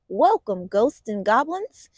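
ordinary delivery

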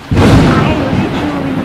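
nearly crying out the words; choked-up outburst; close to tears. Real, not acted. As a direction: voice cracking up loud and high. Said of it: "wanted to cry".

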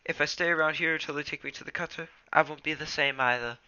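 impatient. Acted. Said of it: "Rusty twitched his tail impatiently".

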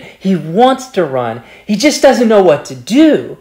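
ordinary talk

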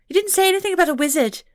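high, fast tiny pixie voice, accusing. A narrator's reading. Pixie to Scott, accusing him in a high, fast voice. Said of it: "she accused him".

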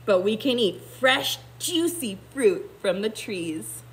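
ordinary delivery

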